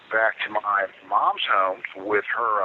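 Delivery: usual speaking voice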